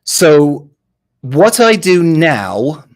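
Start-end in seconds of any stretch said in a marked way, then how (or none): none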